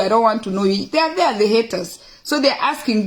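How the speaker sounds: ordinary delivery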